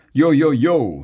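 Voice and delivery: high, loud rapper's "yo"; shouted greeting. A narrator's reading. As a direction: shout it out to hype a crowd. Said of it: "he shouted".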